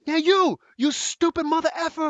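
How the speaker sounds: high, loud imitated screaming; retold cursing yell. Stranger cursing at the narrator in the street, high mock screaming. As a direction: spit it out like a raving stranger. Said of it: "He's, like, cursing at me".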